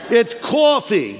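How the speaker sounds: put-on New York accent. Preacher mimics people from New York, New York accent. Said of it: "If you're from New York".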